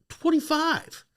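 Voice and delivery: very high little voice; mock timid falsetto; meek squeak. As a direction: squeak out an unconvincing answer to the cop. Sheepish lie to angry trooper.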